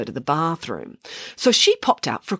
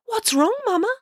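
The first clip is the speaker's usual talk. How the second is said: high, little-bird question; small duckling voice. A narrator's reading. Sixth duckling asks its mother, high and small. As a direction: ask like a tiny worried duckling.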